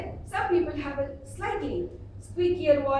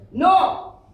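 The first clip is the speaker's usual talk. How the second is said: put-on sharp loud NO to a baby; sudden forceful warning shout; loud scolding; 5 semitones higher, 11 dB louder than usual. Shown as a demonstration. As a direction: raise the voice high and loud to stop a child. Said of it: "So the volume has an impact".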